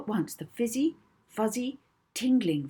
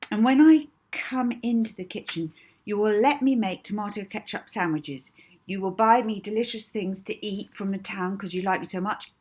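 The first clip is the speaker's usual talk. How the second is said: bossy command; 5 semitones higher, 7 dB louder than usual. Acted. Molly commanding hypnotised Edna.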